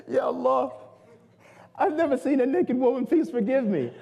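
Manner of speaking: high-pitched tearful pleading; mock crying. Pure youth to Allah after an accidental sight, mock crying. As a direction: imitate a sobbing youth begging forgiveness. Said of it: "the guy starts crying. He's like".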